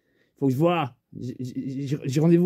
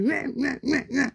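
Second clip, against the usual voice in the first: squeaky high yapping; silly imitation of a small dog barking; mock little dog bark; 11 semitones higher than usual. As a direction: yap like a tiny dog, high and thin. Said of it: "le petit chien, il fait".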